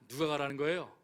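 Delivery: ordinary talk, nothing marked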